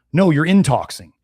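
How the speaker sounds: ordinary talk, nothing marked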